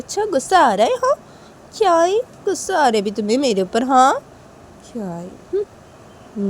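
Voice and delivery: high, loud mocking dare; taunting girl. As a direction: taunt him to get angry. Uma taunting Sanju. (ऊँची तेज़ चिढ़ाती आवाज़)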